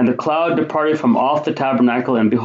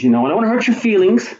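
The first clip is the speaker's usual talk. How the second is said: mock gentle God; 9 semitones higher, faster than usual. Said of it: "He didn't say".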